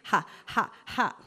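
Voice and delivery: loud, derisive ha ha ha; mocking laugh. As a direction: laugh down at the kids in scorn. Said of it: "laughs like".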